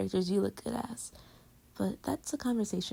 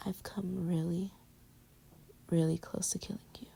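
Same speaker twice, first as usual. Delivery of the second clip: whisper; 3 semitones lower, 5 dB quieter than usual. Pretend. Teasing threat whispered in bed.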